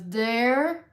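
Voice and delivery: high, loud mock Southern call; husband hollering in a teasing Southern accent. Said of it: "we do it in a Southern accent".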